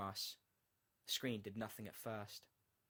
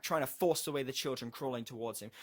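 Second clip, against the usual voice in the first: usual speaking voice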